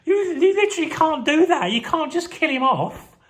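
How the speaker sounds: high, laughing squeal of disbelief; mock outrage. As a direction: shriek a stunned, giggling protest. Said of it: "would be like".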